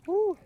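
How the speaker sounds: loud, high whoop from the cold; excited whoop. Real, not acted. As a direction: let out a big shocked whoop. Whoop after dunking in ice.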